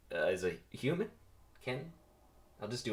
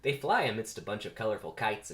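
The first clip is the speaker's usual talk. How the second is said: plain reading voice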